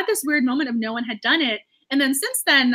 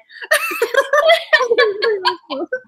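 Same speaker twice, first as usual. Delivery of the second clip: high laugh on the edge of tears; real emotional giggle; teary laugh; 12 semitones higher, 8 dB louder than usual. Real, not acted. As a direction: laugh through welling tears. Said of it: "you guys are gonna make me cry".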